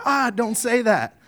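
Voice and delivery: high, loud, drawn-out mortified scolding; slow, high embarrassed "don't"; mortified parent. Acted. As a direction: act out a parent cringing at the child's blunt question. Parents reacting to embarrassing child.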